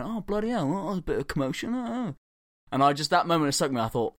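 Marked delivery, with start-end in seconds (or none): 0.0-2.1 imitating unfazed soldier.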